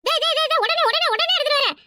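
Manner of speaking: high, hurried yes-yes; eager high voice; flustered eagerness. Acted. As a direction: rush to please, caught off guard.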